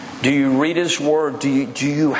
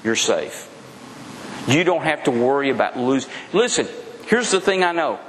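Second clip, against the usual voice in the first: usual speaking voice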